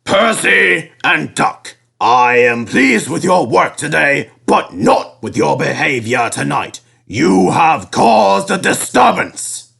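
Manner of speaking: slow, measured stern boss; a strict boss scolding calmly; stern controller. A narrator's reading. Fat Controller scolds engines.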